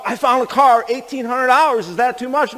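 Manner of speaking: high-pitched imitation of an excited caller; eager news. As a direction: rush in with hopeful news, high. Excited woman phones with news.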